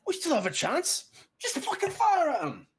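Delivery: high, strained urging; desperate bandit. Acted. Bandit urging his men to fire crossbows, desperate high cry. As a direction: cry out, pushing the others to act. Bandit urges the others to shoot.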